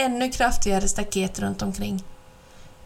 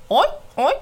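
surprised exclamation; 5 semitones higher, 4 dB louder than usual. Acted. Hens exclaiming from the meadow.

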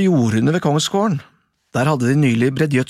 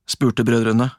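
plain reading voice

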